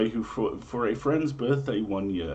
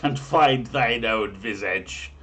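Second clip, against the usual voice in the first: ominous; 6 dB louder, slower than usual. Acted. Ominous slowed punchline.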